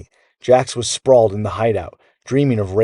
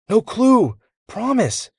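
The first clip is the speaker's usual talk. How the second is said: nervous denial; 13 semitones higher, slower than usual. A narrator's reading. Jax nervously denying.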